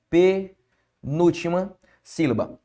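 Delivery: "Penúltima sílaba" slowly, in separated chunks; slow teacher's breakdown of "Penúltima sílaba"; slow, broken up. Shown as a demonstration. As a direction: break it as pe, núltima, sílaba with clear pauses. Teacher repeats term broken into pieces.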